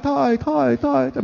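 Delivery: high, fading echo of the last syllable; repeated syllable trailing off like a hall echo; mock echo. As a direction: imitate the church echo dying away. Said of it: "May echo pa eh".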